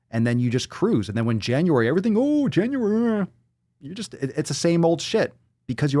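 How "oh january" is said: high, slow mocking "oooh"; mock excitement. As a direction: mimic overexcited resolution-makers, drawn out. Mimics everyone's January excitement.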